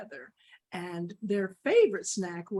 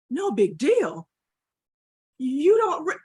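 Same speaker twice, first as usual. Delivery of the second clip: loud, incredulous echo; indignant disbelief; 4 semitones higher, 8 dB louder than usual. Acted. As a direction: throw the words back, outraged. Dog incredulously repeats Cat's words.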